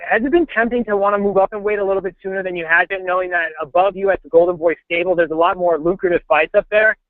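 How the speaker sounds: normal talking voice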